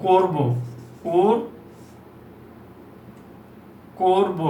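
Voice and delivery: করব broken into "kor... bo"; teacher models করব with o; o-sound demo. Shown as a demonstration. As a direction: round the o in করব, syllable by syllable. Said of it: "উচ্চারণ হবে করব".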